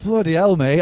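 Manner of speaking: ordinary talk, nothing marked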